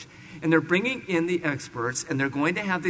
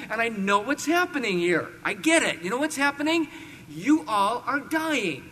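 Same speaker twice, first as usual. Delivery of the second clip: preaching; 4 semitones higher than usual. Acted. Preacher revealing the danger to the crowd, fervent. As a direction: build the revelation with fervor.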